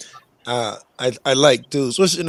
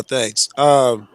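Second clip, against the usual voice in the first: ordinary delivery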